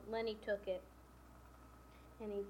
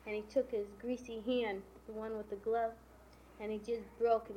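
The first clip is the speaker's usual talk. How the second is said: ordinary delivery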